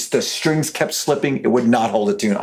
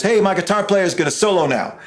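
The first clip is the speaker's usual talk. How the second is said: mock announcement; 8 semitones higher than usual. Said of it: "and he goes".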